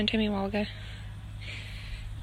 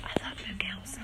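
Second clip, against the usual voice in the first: whisper; 5 semitones lower, 11 dB quieter than usual. Real, not acted. Aside near the cows.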